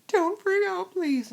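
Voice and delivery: high, loud mock panic; high-pitched pleading outburst, imitated; imitated alarmed plea. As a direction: beg urgently, like a panicked little sister.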